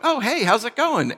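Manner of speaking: high, breezy hello replayed; cheery phone greeting. Answering a friend's call unaware of bad news, cheery. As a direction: act out an oblivious, chipper pickup.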